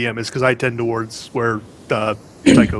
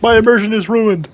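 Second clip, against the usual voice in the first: mock outrage; 9 semitones higher, 4 dB louder than usual. Mock fussy complaint.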